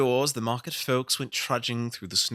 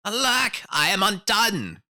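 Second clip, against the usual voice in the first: put-on high, louder, despairing old man's wail; lamenting old tailor; 12 semitones higher, 8 dB louder, slower than usual. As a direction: wring your hands as the old tailor.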